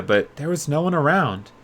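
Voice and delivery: ordinary delivery, nothing marked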